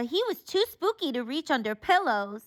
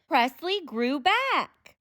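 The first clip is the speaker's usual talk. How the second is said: drawn out, louder, full of wonder; slow dramatic reveal; 3 semitones higher, 6 dB louder, much slower than usual. A narrator's reading. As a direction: stretch each word like a magic moment. Magical turn in the story.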